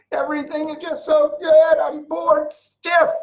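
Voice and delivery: high, mocking voice of someone bored by perfection; sarcastic bored sigh; mock bored whine. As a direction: send up the spoiled complainer. Mock complaint of perfect life.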